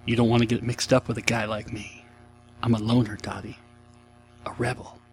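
lightly voiced low, brooding tough-guy line; a hint of Pee-wee playing the loner rebel; Pee Wee Herman impression. Host reciting the movie quote as a mild brooding loner. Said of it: "you'll get to enjoy my rendition of it".

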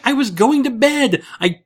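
put-on high-pitched, innocent old grandma; grandma's voice. Grandma explaining to the grandkids why she was naked, high innocent voice. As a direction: raise the voice high and play grandma matter-of-fact. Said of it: "She's like".

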